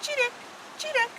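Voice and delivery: very high, soft little chirps; high soft sparrow imitation. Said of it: "Sparrow makes a simple jingle".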